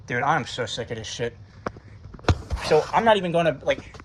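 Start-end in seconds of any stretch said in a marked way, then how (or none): none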